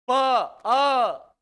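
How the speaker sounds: प opened into a loud, sustained अ; holding the vowel. Shown as a demonstration. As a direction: sound प, then hold its vowel अ. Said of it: "स्वर को पकड़ा हूं".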